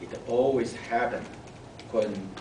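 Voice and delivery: ordinary delivery, nothing marked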